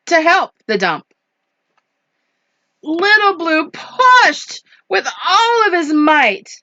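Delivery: animated, swooping picture-book narration; lively story voice with punched words; story reading to children. A narrator's reading. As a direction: build the drama for young listeners. Reads picture book aloud.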